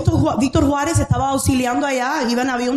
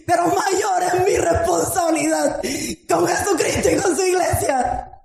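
high, fervent preaching cry; preaching at full force; 6 semitones higher than usual. Acted. Preacher rousing the congregation, fervent high cry. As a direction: lift it up and preach it to the church. Preaching retold farewell to mother.

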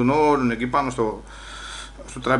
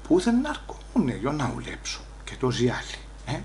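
inner voice; 3 semitones lower, 12 dB quieter than usual. Acted. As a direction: say it under your breath, to yourself. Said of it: "λέει από μέσα μου".

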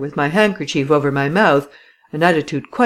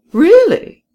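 surprised; 9 dB louder than usual. Acted. Skeptical commissary reacts.

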